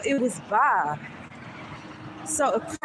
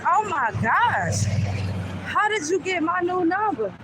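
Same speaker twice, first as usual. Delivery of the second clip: shocked; 7 semitones higher than usual. Retold or imitated. Caller has her new number, shocked. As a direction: stretch out the oh my gosh, stunned. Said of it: "I'm like".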